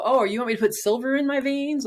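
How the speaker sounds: lightly mocking a pushy practitioner's suggestion; sarcastic mock quote. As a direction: scoff at the offer in disbelief. Mocking the treatments she was offered.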